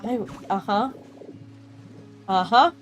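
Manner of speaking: normal talking voice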